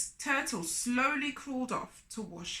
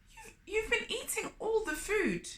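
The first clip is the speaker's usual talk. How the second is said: higher, dismayed Turtle; hurt surprise at the empty plates; a touch of dismay, lightly voiced Turtle; unhappy; 10 semitones higher than usual. A narrator's reading. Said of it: "Turtle said, unhappily".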